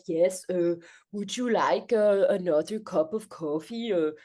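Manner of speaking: put-on halting Danish accent with filler uhs; stilted English in a put-on Danish accent; mock Danish-accented English. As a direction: imitate a hesitant Dane speaking English. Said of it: "obviously this is in English".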